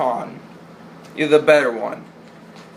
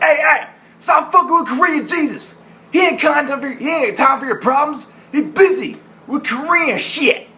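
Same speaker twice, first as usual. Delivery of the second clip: imitating [name]; 12 semitones higher than usual. Quoting [name]'s Korean Jesus line, high mock rant. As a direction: bark it like an irritated cop doing a bit.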